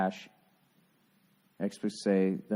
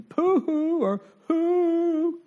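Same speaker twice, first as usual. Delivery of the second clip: hammed-up falsetto sob, much higher and louder; mock crying; 7 dB louder, much slower than usual. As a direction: fake a wailing sob for laughs. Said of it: "the impression me and Paul do when we do the crying thing".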